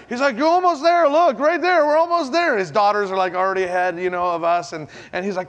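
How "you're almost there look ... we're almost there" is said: encouraging coach. Retold or imitated. Friend coaching from the water.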